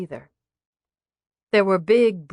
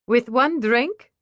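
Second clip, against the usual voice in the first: higher, drawn-out scoffing question; 5 semitones higher, 4 dB louder, slower than usual. Acted. Waitress doubts the mother's claim, scoffing. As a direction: scoff in mild disbelief, pitch climbing at the end. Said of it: "Stacy scoffed".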